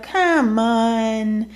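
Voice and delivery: lightly voiced wheedling "Come on"; a hint of a pushy suitor coaxing; imitating a man trying to talk her round; mock pleading guy. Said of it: "when guys would be like".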